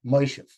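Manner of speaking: Moshev said as Moishev with an oi vowel; O becomes Oi. Shown as a demonstration. Teacher shows the Klal Yiddish oi: Moishev. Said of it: "It becomes Oi".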